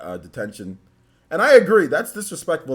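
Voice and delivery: ordinary talk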